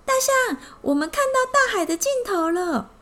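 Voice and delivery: happy ant cheering. Acted. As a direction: burst out with joy. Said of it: "蚂蚁开心地说".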